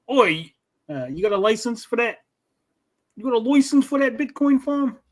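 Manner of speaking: high-pitched cartoon Aussie cop; mock Australian accent. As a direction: put on a broad, botched Australian accent. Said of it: "That was Australian, sorta".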